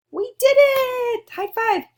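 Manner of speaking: high, loud, slow cheer of triumph; overjoyed teacher shouting praise; excited cheer. Real, not acted. Class finishes the algorithm.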